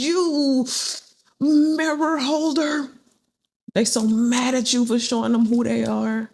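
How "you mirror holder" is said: higher, slow sing-song taunt; mocking name-calling. Angry people calling the reader names, in a higher, slow taunt. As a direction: drag it out like a sulky insult. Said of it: "all they can call you is".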